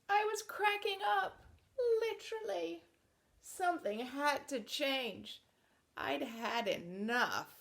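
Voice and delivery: picture book narration; reading to children. Acted. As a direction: read it out to a room of kids.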